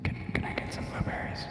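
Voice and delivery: breathy whispered ask, no voice. Pretend. Streamer asking chat for blueberries in a whisper. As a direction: whisper "Can, can I get some blueberries?" like a secret. Same plea repeated in a whisper.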